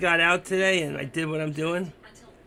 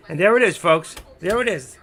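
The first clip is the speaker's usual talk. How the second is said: excited; 9 dB louder than usual. Real, not acted. Market spike, calling out.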